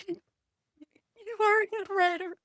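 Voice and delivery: high, strained voice fighting back tears; husband, moved by a review, trying not to cry; imitating choked-up husband. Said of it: "trying not to cry".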